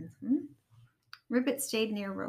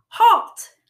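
very loud, high shout; Robot at full volume, halting them; booming command; 11 semitones higher, 16 dB louder than usual. A narrator's reading. Robot stopping the fight between Rabbit and Ribbit, shouted. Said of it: "turned up his volume knob".

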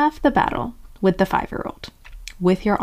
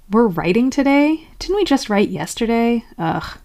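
reluctant whine; 3 semitones higher than usual. Acted. Inner five-year-old objecting to writing, mock reluctance. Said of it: "when my automatic brain says".

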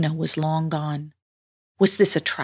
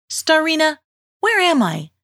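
put-on questioning young heroine; 6 semitones higher than usual. Girl tests the mermaid with another question, inquiring. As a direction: call out high and press with questions.

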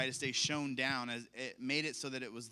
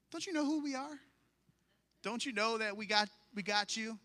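reassuring dad; 8 semitones higher than usual. Retold or imitated. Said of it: "we had to remind her".

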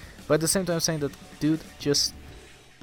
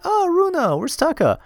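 very high, excitable cartoonish voice; eager greeting; 4 dB louder than usual. Visitor asks little sister after her brother, eager high voice. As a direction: imitate a childish friend, pitched way up.

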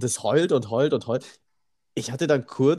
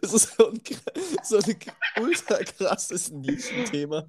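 laughing; 8 semitones higher, 3 dB quieter than usual. Real, not acted. Cracking up over spaghetti ice as a niche topic, laughing. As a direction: laugh your way through it.

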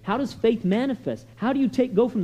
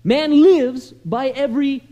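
measured, emphatic pulpit recitation; preacher reciting scripture; 5 dB louder than usual. Acted. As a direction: declare the verse, pausing before each big word.

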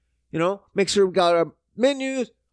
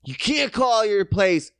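normal talking voice

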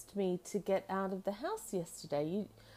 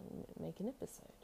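ordinary delivery

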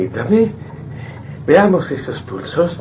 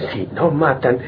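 usual speaking voice

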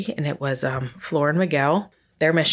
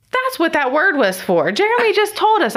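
excited realization; 13 semitones higher, 5 dB louder than usual. Real, not acted. Complaining to best friend Shar, then excitedly realizing. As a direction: pitch leaps high and louder with discovery.

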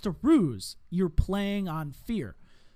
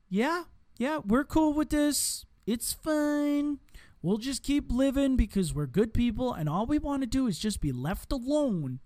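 high, breezy mock complacency; mock complacent citizen; 6 semitones higher than usual. Mocking complacent people who say it's all fine. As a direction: play a carefree citizen shrugging it off. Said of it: "to just go".

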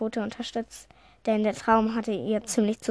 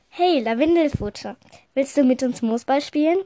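louder, higher playful invitation; calling out; 3 semitones higher, 7 dB louder than usual. A narrator's reading. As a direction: call a friend over to play. Freund ruft zum Spielen herüber. (fröhlich herüberrufen)

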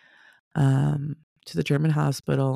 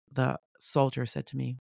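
ordinary talk

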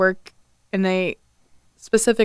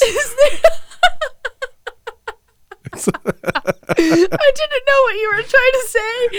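laughing while talking; 14 semitones higher, slower than usual. Real, not acted. Laughing at pumpernickel slip.

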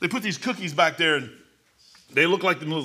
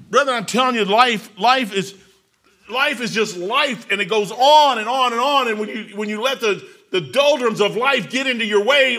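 loud, high fervent preaching; 7 semitones higher, 8 dB louder than usual. Acted. Preacher pressing the congregation with loud force. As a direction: drive it home to the church.

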